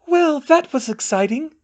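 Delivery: high, loud and cheerful. Acted. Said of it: "Luna said cheerfully".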